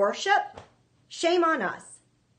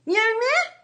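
mask lady imitation; 13 semitones higher, 7 dB louder than usual. Mimicking the mask lady again.

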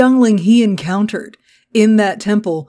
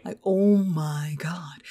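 whispered; 3 semitones lower, 7 dB quieter, slower than usual. Real, not acted. Stunned aside.

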